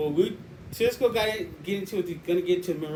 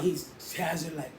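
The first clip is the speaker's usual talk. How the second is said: ordinary delivery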